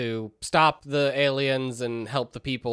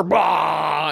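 mock shout; 3 semitones higher, 6 dB louder than usual. Preacher pulled into ceiling.

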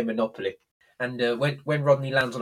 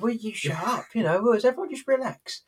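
high, exasperated mock shout; exasperated outburst; 11 semitones higher than usual. Del Boy shouting at jumpy family to stop panicking, exasperated shout.

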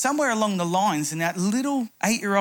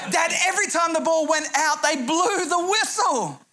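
put-on angry child shout; 9 semitones higher than usual. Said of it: "he was so angry".

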